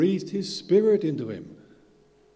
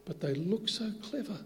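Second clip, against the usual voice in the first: whispered aside; 5 semitones higher, 8 dB quieter than usual. Real, not acted. Whispered aside about idols.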